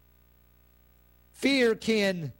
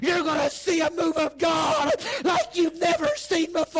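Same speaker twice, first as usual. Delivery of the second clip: high, strained, full-force sermon cry; impassioned preacher at the peak; shouted preaching climax; 10 semitones higher, 5 dB louder, slower than usual. Real, not acted. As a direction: cry it out to the congregation at fever pitch.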